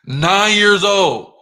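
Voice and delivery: loud, high, drawn-out exclamation; emphatic shout. Real, not acted. Boasting to listeners how early he started, emphatic shout. Repeats his age emphatically.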